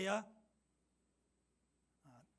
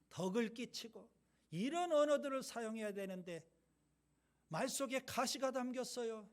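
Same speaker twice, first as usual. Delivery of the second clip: ordinary delivery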